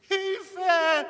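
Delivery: high cry from far off; distant cry for help. Acted. Lost man cries for help in the darkness, far off. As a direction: call for help from far down the tunnel.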